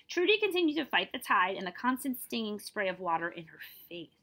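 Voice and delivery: ordinary delivery, nothing marked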